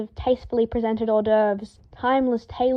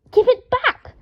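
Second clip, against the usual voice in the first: much higher, louder shrieking demand; scream; 13 semitones higher, 6 dB louder than usual. A narrator's reading. Draco screams at the ferret tugging his robes. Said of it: "Draco screamed".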